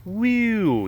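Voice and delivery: high mock whoops of an excited cat. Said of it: "just kinda like".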